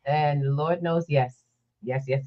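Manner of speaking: ordinary talk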